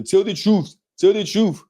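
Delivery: high-pitched mock movie accent; Will Smith's movie accent. Podcaster mocking a star's movie line, exaggerated foreign accent. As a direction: imitate a terrible film accent, pitched well up. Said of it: "You see that terrible ass, uh, accent Will Smith had".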